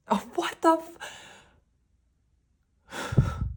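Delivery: high, loud startled gasp of a cry; real shock. Real, not acted. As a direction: caught off guard by the card. Shocked at the top card.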